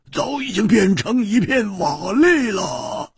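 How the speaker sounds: sneering cold laugh. Acted. 暗处一个人冷笑着嘲讽三人, scornful voice rising to a shout. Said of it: "冷笑说".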